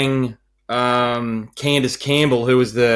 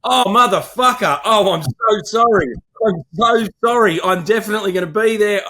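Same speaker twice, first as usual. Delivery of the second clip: panicked apology; 9 semitones higher than usual. Retold or imitated. Celebrant realising he's late, apologising to bride in a high panic. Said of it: "and I was like".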